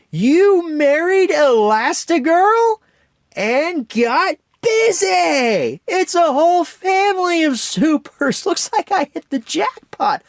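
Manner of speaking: villain Syndrome gloating to Bob in a southern drawl; Syndrome's southern drawl. Retold or imitated. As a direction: gloat in an over-the-top southern drawl, slow at first. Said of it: "he goes into a brief southern drawl there".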